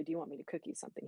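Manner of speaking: whispered fast, barely voiced; hushed morning offer. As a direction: breathe it out quick and soft, not to wake anyone grumpy. Quoting morning offer to husband.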